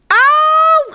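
een hoge, harde pijnkreet; pained yelp. Acted. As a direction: gillen als de zalf op de verbranding komt. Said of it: "gilt Jip". (hoge harde auw)

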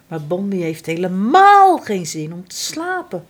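ordinary delivery

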